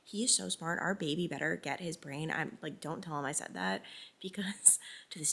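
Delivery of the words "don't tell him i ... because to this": whispering. Real, not acted. Secret she hides from husband.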